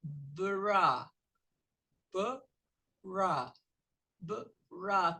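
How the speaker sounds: bra with the b and r split apart; separated bra. Shown as a demonstration. Teacher showing how to split bra into sounds. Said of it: "você vai pronunciar de separado, ó".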